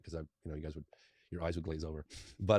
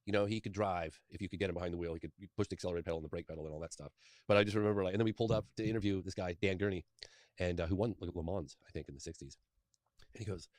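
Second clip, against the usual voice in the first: ordinary delivery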